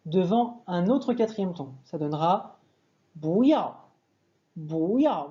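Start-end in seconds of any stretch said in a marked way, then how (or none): 3.2-5.3 "bu" rises, "yao" falls; bu au deuxième ton. Shown as a demonstration. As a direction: lift "bu" then drop "yao". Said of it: "qui deviendra bu, deuxième ton". (« bu » montant, « yao » descendant)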